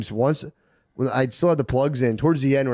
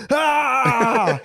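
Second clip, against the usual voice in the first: pained yelp; 12 semitones higher, 3 dB louder than usual. Retold or imitated. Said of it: "squeezing it and being like".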